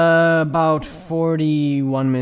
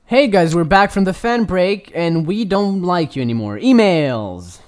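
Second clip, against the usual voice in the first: loud, higher host announcement; mock show host; 5 semitones higher, 7 dB louder than usual. Host back from the fan break, loud mock announcing. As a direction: announce it big, like returning from a break.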